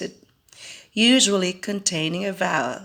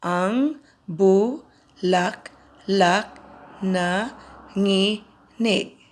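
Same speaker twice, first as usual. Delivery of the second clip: Ang bulaklak na nginig said slowly, with pauses; slow syllable count; much slower than usual. Shown as a demonstration. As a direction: spell out the beats slowly. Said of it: "line two".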